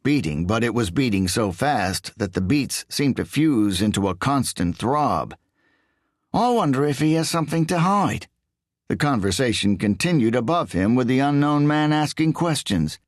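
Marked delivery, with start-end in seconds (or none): 6.3-8.3 high, whiny English voice; whiny Englishman. A narrator's reading. English thief quizzes Riso in a high whiny voice. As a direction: push it up into a whine.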